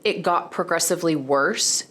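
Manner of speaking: ordinary talk, nothing marked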